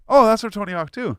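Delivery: high, loud burst of excited recognition; sudden recognition. Acted. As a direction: act out someone suddenly placing a song. Acted listener suddenly recognizing the song.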